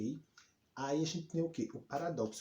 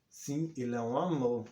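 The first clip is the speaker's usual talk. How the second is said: ironic praise; 5 dB louder than usual. Shown as a demonstration. Answering whether she likes Alê, who is annoying, sarcastic. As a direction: praise them while meaning the opposite. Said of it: "tô dizendo que o troço é chato".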